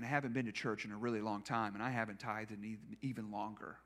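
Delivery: barely keeping it together while retelling; soft voice on the edge of tears; emotional, holding back tears. Real, not acted. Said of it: "I am an emotional wreck this week".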